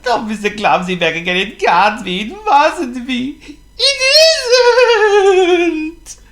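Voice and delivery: Fussy praying his messy cousin isn't back; mounting panic. Acted. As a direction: plead with rising panic. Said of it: "he groaned".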